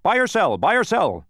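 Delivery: loud, high, insistent repetition; impatient. A narrator's reading. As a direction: demand an answer impatiently. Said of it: "repeated the gateman impatiently".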